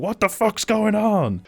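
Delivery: high, drawn-out mock yell; hammed-up incredulous holler; Danny McBride's freak-out. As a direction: imitate a background outburst, slow and loud. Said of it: "fucking Danny McBride in the background like".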